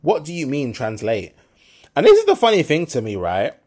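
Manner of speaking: usual speaking voice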